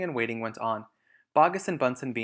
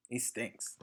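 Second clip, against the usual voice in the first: disgusted aside; 10 dB quieter than usual. Acted. Fox sneers at Bean's smell.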